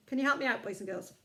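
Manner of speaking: ordinary delivery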